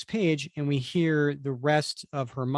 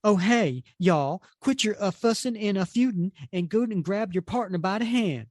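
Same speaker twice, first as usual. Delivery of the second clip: higher-pitched hammed-up country accent; mock prairie accent; 5 semitones higher than usual. Reader voices a teammate ridiculing Pioneer's speech, mock prairie accent. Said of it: "that ridiculous fake accent".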